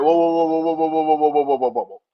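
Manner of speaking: loud rapid whoas fading down; frantic calming. Retold or imitated. Sammy stepping between Roman and Jey, frantic and loud. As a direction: hold everyone back, hurried. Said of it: "Sammy's like".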